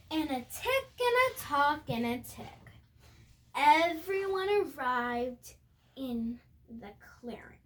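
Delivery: plain reading voice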